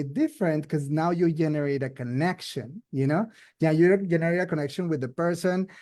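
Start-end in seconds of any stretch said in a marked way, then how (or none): none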